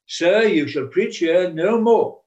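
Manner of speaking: slow, loud, stern rebuke; loud slow mock-stern ban; stern church officer. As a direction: forbid him like an outraged official.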